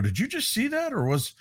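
high-pitched re-enacted double take; mock startled asking; startled question, retold. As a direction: jump up in pitch, checking what was seen.

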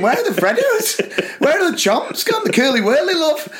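high, mock-despairing questions; exasperated plea. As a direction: lament the cheap chocolate. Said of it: "I'm thinking".

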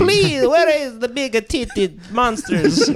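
high, slow, pleading fake Italian; fake Italian accent. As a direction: beg it in a hammy Italian accent. Italian character bit.

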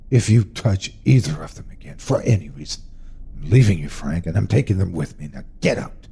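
hushed, deadly serious warning; whispered threat. Acted. Mother threatening husband by sleeping son, whispered. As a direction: threaten without waking the boy.

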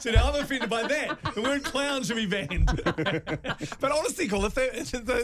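laughing. Real, not acted. Laughing back at the joke.

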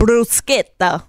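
slow bruschetta, broo-SKET-ta; correct Italian pronunciation. Shown as a demonstration. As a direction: draw out bruschetta with a hard "sk". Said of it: "that's how you meant to say it".

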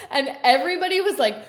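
high, loud and laughing. Real, not acted. Actress laughing while telling the host her story. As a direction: laugh through the punchline, squealing high.

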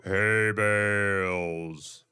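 slow, low, stretched out; mock solemn greeting; low drawn-out name. Playful name-calling bit.

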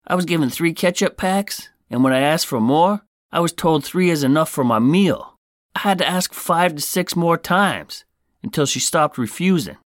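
high-pitched mock voice of an outraged customer; hammed-up high voice of a customer griping about ketchup; mock complaining customer. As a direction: read the review like a fed-up, whiny customer. Reading an angry customer review.

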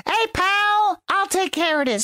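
high mock tough guy; imitating Joey. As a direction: play the cocky pal. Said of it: "he says".

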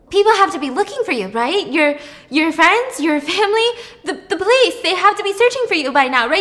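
acted frantic hope, pitched well up; anxious insistence. Noah tells captive Penny they will be found, frantic.

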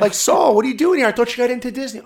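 high, loud mocking classmate voice; taunting classmates. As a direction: jeer at him like a smug schoolmate. Classmates taunting him in the halls.

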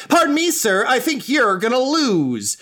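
put-on high-pitched twelve-year-old wrestler's taunt; Draken. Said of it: "Draken just points at you and goes".

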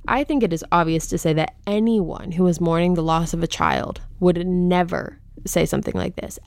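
ordinary delivery

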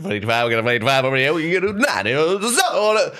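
high, fast auctioneer patter. Retold or imitated. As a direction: rattle off the bids, rising. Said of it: "I did auctioneering in my free time".

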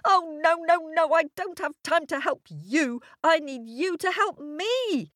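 put-on high, exasperated child refusal; stamping little girl. A narrator's reading. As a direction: protest urgently in a high-pitched voice. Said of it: "stamping both her feet".

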